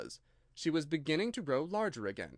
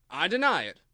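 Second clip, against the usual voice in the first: louder flat denial; firm louder denial; louder March Hare; 4 semitones higher, 8 dB louder than usual. A narrator's reading. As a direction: deny it firmly.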